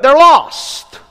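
high and loud, driving it home; a loud, high, grave verdict; emphatic cry. Real, not acted. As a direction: proclaim it with full force. Preacher drives home the point.

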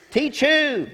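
ordinary talk

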